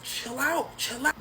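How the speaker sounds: high soothing chill out; calming down. Retold or imitated. [name] calming [name], high soothing.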